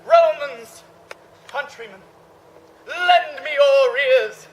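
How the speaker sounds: loud, slow theatrical speech; grand oration. Acted. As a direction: address the crowd grandly. Famous oration.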